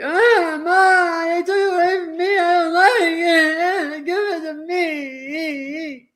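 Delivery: put-on high, loud whining child crying for Ma; wailing toddler. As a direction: wail like a toddler whose toy was taken. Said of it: "could go back to two years old".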